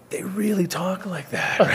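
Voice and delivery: slow, high, laughing disbelief; amazed. Retold or imitated. Retelling James hearing a Boston waitress, in slow, high, laughing disbelief. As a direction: imitate a stunned friend. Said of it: "And he's like".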